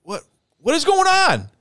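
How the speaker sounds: rising high alarm. Retold or imitated. Narrator calling to Corey in the dark, rising alarm. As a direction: call out in confusion. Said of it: "And I'm like".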